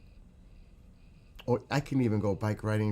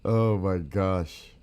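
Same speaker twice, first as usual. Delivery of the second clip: drawn-out, louder oh my gosh; slow surprised gasp; 5 dB louder, much slower than usual. Real, not acted. Reacting to a viewer's changed look, slow and amazed. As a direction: stretch it out, amazed.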